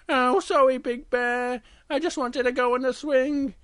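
high-pitched cub saying sorry; apologetic little bear. Acted. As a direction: plead in a small high voice. Little Bear apologises after scolding.

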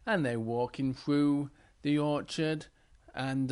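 plain reading voice

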